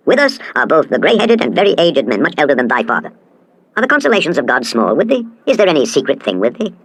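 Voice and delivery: ordinary delivery, nothing marked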